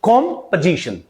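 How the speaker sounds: "Composition" as KOM-puh-ZI-shun, drawn out; correct pronunciation. Shown as a demonstration. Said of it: "How you will pronounce this word?". ("Composition" का सही उच्चारण, धीरे से)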